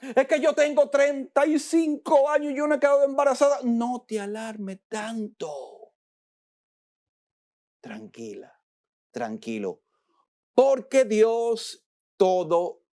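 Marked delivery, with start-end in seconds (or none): 0.0-3.6 higher, fast fretting; mock a woman anxious about childlessness; preacher imitating a fretful woman. Mimics worried woman. 3.6-9.8 preacher hushing the woman into calm; hushed calming. Pretend. Soft calming reply.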